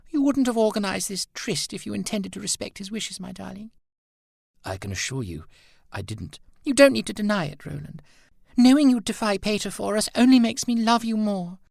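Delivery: put-on a girl insisting he arranged the tryst.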